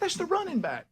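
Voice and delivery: high-pitched exasperated husband; mock exasperation. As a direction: correct her in a high, comically frustrated voice. Acted quarrel with confused wife.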